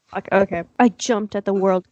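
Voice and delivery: ordinary talk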